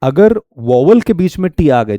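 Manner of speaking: ordinary delivery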